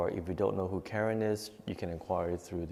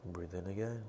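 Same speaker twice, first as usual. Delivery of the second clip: guided breathing meditation; 11 dB quieter than usual. Acted. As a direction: calmly guide each breath, fading to near whisper. Leads nine-round breathing meditation.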